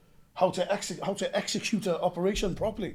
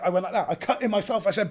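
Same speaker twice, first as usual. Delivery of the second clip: ordinary talk, nothing marked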